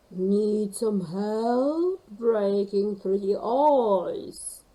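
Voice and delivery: slow, booming giant whale. A narrator's reading. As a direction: rumble slowly like a huge whale. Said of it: "asked a blue whale from the deep".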